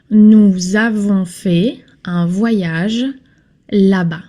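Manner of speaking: "Nous avons" slowed right down, with the z clearly linked into "avons"; slow liaison demo. Shown as a demonstration. Teacher slowly modelling the liaison in "nous avons". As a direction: stretch "Nous avons fait un voyage" out slowly to show the liaison. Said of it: "Nous avons fait liaison".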